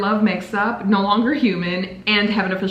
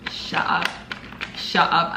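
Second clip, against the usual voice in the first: stunned whisper; 4 semitones lower, 4 dB quieter than usual. Real, not acted. Stunned by the gift.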